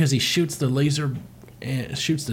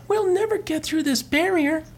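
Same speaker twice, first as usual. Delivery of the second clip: high female cartoon voice. Voicing Farah in cartoon.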